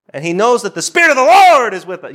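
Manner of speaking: high, loud imitation of a fiery preacher; preacher's yell. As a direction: belt it out like a passionate preacher. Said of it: "You know, he talks like that".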